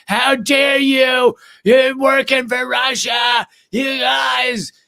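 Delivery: high, slow, shrill-free yelling accusation; mock outraged shout. Comedian ex-friend attacking them on Twitter, hammed-up angry shout. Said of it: "being like".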